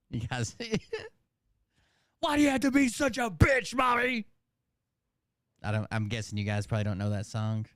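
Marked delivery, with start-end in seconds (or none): 2.2-4.3 put-on child shouting. Child yelling at mother.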